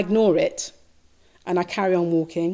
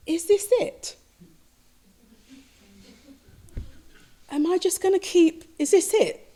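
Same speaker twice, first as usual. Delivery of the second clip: anxious questioning; 8 semitones higher than usual. Retold or imitated. Inner despair in retold dream.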